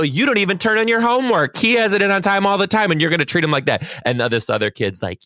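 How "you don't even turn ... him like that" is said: high, indignant scolding; berating. Retold or imitated. Teacher berating a student over homework, high and indignant. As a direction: re-enact an outraged telling-off. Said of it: "berated her in front of everybody".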